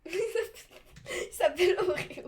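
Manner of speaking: slow words through laughter; laughing. Real, not acted. Laughing at the cat name.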